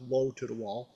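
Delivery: normal talking voice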